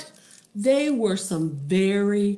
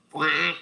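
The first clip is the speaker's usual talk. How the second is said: single duck quack; short cartoon duck call; imitated quack; 4 dB louder than usual.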